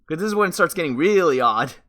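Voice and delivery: high, loud bewilderment; bemused emphasis. Real, not acted. Player warning that the story gets odd, bemused.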